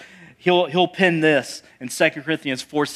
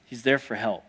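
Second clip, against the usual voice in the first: ordinary talk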